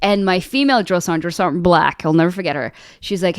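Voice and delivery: ordinary talk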